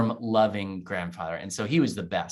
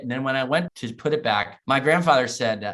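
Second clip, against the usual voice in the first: ordinary talk, nothing marked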